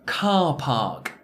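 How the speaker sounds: car park with two long ahs. Shown as a demonstration. Teacher models car park for repetition. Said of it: "Try and repeat this after me".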